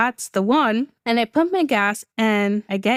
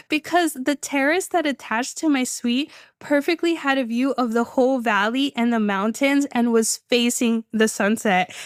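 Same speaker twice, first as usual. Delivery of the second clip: normal talking voice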